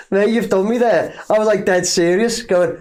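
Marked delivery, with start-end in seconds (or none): none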